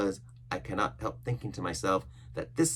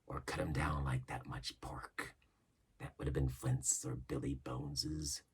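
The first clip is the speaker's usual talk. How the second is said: whisper; 6 semitones lower, 9 dB quieter than usual. Acted. Cook proposing murder, menacing whisper. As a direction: let the threat sink in.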